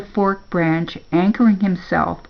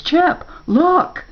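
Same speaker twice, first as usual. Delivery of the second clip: higher-pitched, eager call to a friend; excited discovery; 10 semitones higher than usual. A narrator's reading. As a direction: call out as if just spotting good news. Spark notices goo sinking.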